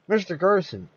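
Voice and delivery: high urgent cry; cried out. A narrator's reading. Sam crying out to Phil in the lobby, urgent. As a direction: call out to him. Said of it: "cried Sam".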